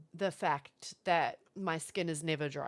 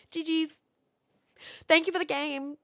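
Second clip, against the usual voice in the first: mock-polite sign-off; 8 semitones higher, 4 dB louder than usual. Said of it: "I'll just be like".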